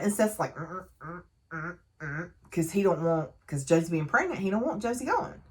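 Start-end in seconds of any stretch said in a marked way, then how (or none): 0.6-2.3 soft, closed-mouth no-no hums; discreetly signal someone to stop; retold warning hum. Seth warning Deacon off, hushed warning hums.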